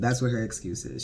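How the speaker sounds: ordinary talk, nothing marked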